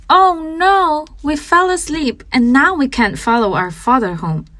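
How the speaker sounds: high, lightly voiced whimpering boy; a hint of a whimper, pitched high; lightly voiced scared boy waking alone in the woods. A narrator's reading. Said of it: "Hansel whimpered".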